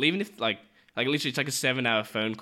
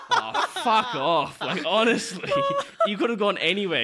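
exasperated retort; 9 semitones higher, 3 dB louder than usual. Retold or imitated. Annoyed at chatty urinal neighbour.

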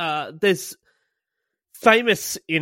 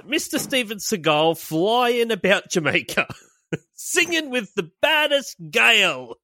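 put-on Jamaican accent; 7 semitones higher than usual. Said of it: "[name], uh, says".

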